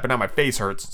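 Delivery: ordinary delivery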